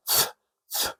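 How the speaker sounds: "th" as a soft voiceless hiss of air; light th. Shown as a demonstration. Teacher isolating the sound of T H, light "th". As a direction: breathe out "th" with tongue between the teeth. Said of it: "it means it's a light".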